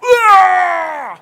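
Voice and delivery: huge high roar like a beast; Esau's mighty battle roar; roaring shout. Retold or imitated. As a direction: bellow it out wildly. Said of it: "Dan dia kalau teriak".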